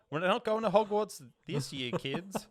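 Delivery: mock German official. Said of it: "German Hogwarts conductor".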